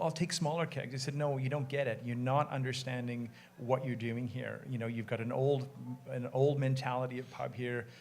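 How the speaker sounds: ordinary delivery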